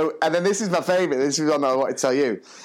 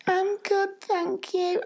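put-on tiny, very high child voice; squeaky small-child reply; little girl; 4 dB quieter, much slower than usual. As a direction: imitate a shy little girl, small and soft. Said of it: "And she's like".

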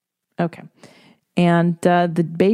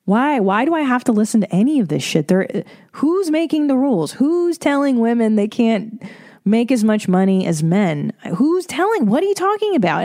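rising, higher and higher outraged questions; indignant rant; 4 semitones higher than usual. Retold or imitated. Woman questioning who makes the rules, rising outrage. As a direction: build to a high, disbelieving peak. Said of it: "you're like".

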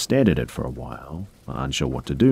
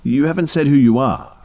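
firm refusal; 11 semitones higher, 7 dB louder than usual. Acted. Refusing stranger at door, firmly.

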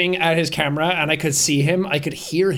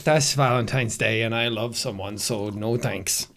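mock smug refusal; 8 semitones lower, 6 dB quieter than usual. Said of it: "And I was like".

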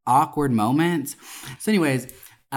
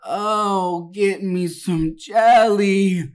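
high, slow and louder, drawn-out whine; loud moaning demand; 6 semitones higher, 4 dB louder, much slower than usual. Retold or imitated. Woman in the booth loudly demands jelly, high and drawn out. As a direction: belt it out like she's the only one in the room. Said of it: "she was so loud".

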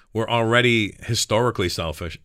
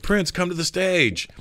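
higher, drawn-out call from the stage; retold stage call; 6 semitones higher, slower than usual. Bandleader inviting [name] onstage, retold drawn-out call. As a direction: imitate a slow announcing call. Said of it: "And [name]'s like".

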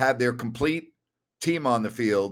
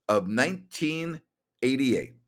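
usual speaking voice

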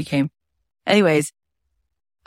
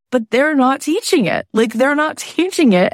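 excited realization; 8 semitones higher than usual. Retold or imitated. Self-talk quote, excited realization.

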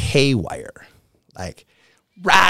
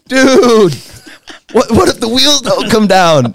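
much higher and louder, frantic; panicked yell; 12 semitones higher, 6 dB louder than usual. Retold or imitated. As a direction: shout the fear of a crash landing. Fear that wheels won't deploy.

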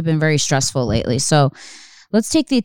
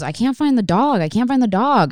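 lightly voiced panicked boyfriend, higher; panicked quote; 8 semitones higher than usual. Retelling her boyfriend's panic over the lost dog, mock worried. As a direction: mimic his worried texts, pitched up. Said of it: "And Tommy texts me and goes".

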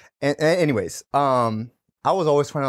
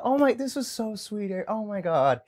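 higher, sweet and cooing; light girl voice; 7 semitones higher, 5 dB quieter than usual. Retold or imitated. Imitating Brittany thanking him for the candy, higher. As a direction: coo it as if touched by the gift.

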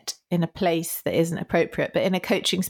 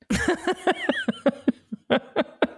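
high burst of laughter; 9 semitones higher than usual. Real, not acted. Laughing high at her own acted apology.

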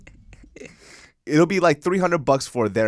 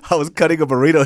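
ordinary delivery

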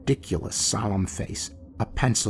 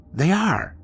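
eager echo; 7 semitones higher than usual. A narrator's reading. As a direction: echo the summons brightly. Instant eager reply.

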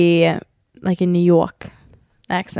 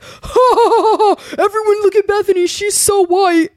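hammed-up ridicule, far higher, stretching the words; mocking mother-in-law; 11 semitones higher than usual. Imitating mom and nana laughing at her, high mocking voice. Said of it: "Your mom and nana will be like".

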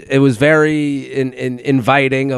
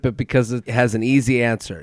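ordinary talk

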